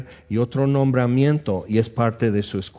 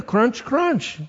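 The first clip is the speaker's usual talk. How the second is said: high imitated crunch; playful crunching sound; crunching bear noise; 11 semitones higher than usual. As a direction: mimic the bear chewing.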